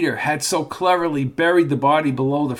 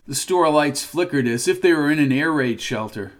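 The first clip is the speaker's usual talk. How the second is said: ordinary delivery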